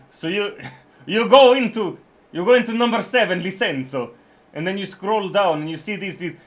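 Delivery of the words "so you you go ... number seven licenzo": put-on Italian accent. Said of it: "There will be an Italian accent".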